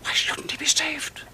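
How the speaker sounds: slow, urgent whisper, pitch up high; a desperate whispered question; pleading whisper. Acted. Desperate plea to woman.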